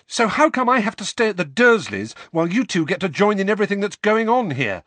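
fast, rising to loud. Acted. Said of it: "his voice growing louder with every word".

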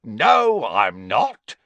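a spiteful tattletale, pleased; gleeful Filch. Acted. Narrator voices Filch.

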